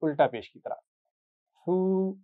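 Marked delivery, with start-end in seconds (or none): 1.7-2.2 फू with the u held long; drawn-out fu. Shown as a demonstration. Teacher drills a student on the long vowel: stretched फू. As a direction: stretch फू out slowly, no whistle. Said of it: "थोड़ा खींच कर पढ़ेंगे".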